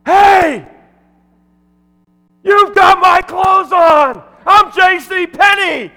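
very high, drawn-out full-force yell; outraged shout. Retold or imitated. Man in bear cloth accusing the MC of wearing his clothes, outraged shout. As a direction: bellow the punchline at the top of your voice. Acted punchline, man chases him out.

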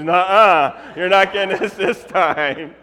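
loud, slow scoffing refusal; won't be fooled twice; disbelieving friend. Retold or imitated. Friend refusing to believe twin news, loud scoff. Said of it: "Phyllis goes".

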